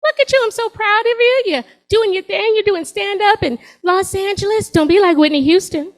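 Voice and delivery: put-on high sing-song old grandma; proud grandmother. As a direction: gush like a doting grandmother. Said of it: "she's like".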